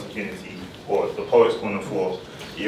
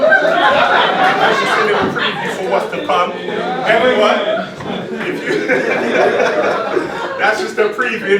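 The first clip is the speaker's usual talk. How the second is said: higher, louder talk broken by laughter; laughing; 10 semitones higher, 6 dB louder than usual. Real, not acted. Host laughing at his own intense poem, higher and louder. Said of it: "I'm emotional".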